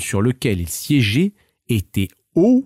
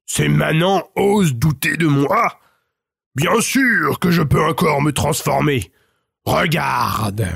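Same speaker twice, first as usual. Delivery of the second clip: higher, offended ogre voice; furious ogre; 5 semitones higher than usual. A narrator's reading. Ogre insulted by the villagers' doubts, indignant higher voice. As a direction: bristle with wounded pride.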